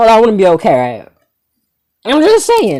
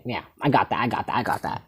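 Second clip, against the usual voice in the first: low, soft, repetitive brush-off; quiet, lowered, sarcastic acknowledgement; dismissive mumble; 6 semitones lower, 11 dB quieter than usual. Retold or imitated. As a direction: wave it away under your breath. Sarcastic reply in retold spat.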